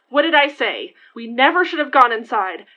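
loud, frightened reproach; panicking and blaming her; scared and upset. Acted.

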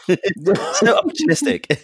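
slow laughing jab. Real, not acted. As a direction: drawl it out through laughter. Laughing accusation.

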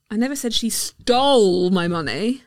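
"stole" punched; stress on STOLE. Shown as a demonstration. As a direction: lean on "stole". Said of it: "depending on the stressed word".

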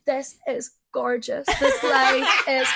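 slow, drawn-out gushing; dreamy awe. Acted. Playing the main character strolling through London, slow dreamy awe. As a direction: marvel slowly, stretching every word out.

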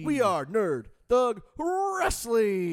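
loud, slow wrestling hype yell; hype shout. Pretend. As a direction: bellow the show name to the crowd. Shouted show name.

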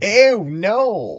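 loud, high grossed-out "ew"; disgusted by taste. Real, not acted. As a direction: recoil at the thought of the food. Reaction to doner meat.

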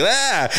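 high open-mouthed "ah"; imitated eating noise. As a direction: mimic a bouncing kid trying to catch food. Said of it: "trying to eat".

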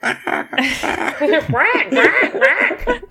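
high squawked bird cries; squawking bird. Acted. Player teasing blinded Astra, high bird squawks. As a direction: squawk it like a bird through laughter. Mimics bird noises amid laughter.

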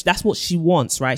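usual speaking voice

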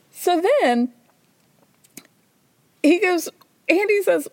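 normal talking voice

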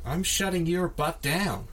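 slow, high imitation of an interview line; a light Tarantino take. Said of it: "That's kind of it".

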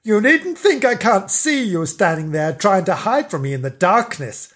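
Mister Flange getting cross. Acted. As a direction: scold the figure in the dark. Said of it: "Mister Flange grew cross".

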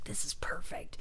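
hushed, whispered realization; awed whisper. Retold or imitated. Comedian recalling finding the show's title, whispered delight. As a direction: whisper it as a private revelation. Retells own reaction to title.

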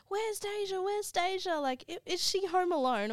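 worried relatives. Retold or imitated. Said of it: "Everyone was like".

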